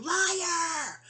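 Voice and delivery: high, drawn-out imitation yell; high-pitched accusing cry, stretched out; mock yelling. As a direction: imitate her hollering an accusation. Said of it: "she'll be yelling".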